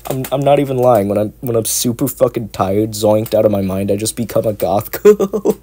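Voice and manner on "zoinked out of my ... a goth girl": ordinary talk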